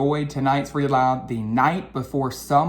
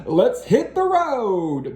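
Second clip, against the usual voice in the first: chuckling Dad; 7 semitones higher, 4 dB louder, slower than usual. Acted. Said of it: "He chuckled and said".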